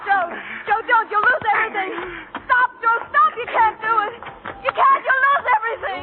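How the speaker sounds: frantic pleading. Acted. Girl screams at Joe to stop the fight, frantic. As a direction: beg him to stop before he kills.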